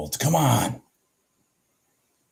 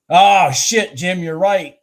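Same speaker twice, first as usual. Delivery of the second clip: sudden realisation; 8 semitones higher, 9 dB louder, slower than usual. Real, not acted. Reacting to a chat comment.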